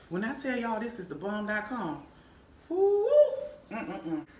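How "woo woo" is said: very high, loud woo of delight; delighted whoop. Real, not acted. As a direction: let out a high whoop over the food. Whoops over how good it is.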